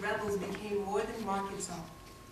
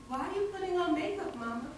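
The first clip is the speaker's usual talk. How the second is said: much higher-pitched questioning voice; puzzled child; 9 semitones higher than usual. Acted. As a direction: ask Mama in a high, childlike voice. Child narrator questions mother in story.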